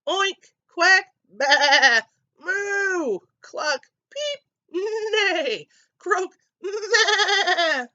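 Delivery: high, loud string of animal noises; animal chorus. A narrator's reading. Animals jump in for a ride, noisy chorus. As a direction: switch animal to animal, bleating and squealing.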